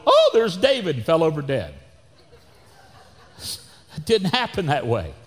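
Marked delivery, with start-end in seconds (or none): 0.1-1.0 mock Goliath. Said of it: "Goliath went".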